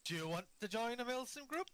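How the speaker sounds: high, eager question to new guys; lightly mocked eager gamer. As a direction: pitch it up like a keen recruiter. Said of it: "Like that".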